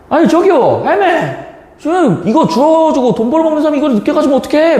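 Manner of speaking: high-pitched, nagging mock tirade; rude scolding employee. Rude office worker berating an elderly scrap collector, high scolding. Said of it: "막 이렇게 막 얘기를 한 거야".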